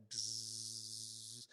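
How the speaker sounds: soft buzzing bee imitation; low, soft bzzz of a bee. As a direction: buzz quietly like a bee. Imitating a bee near his head.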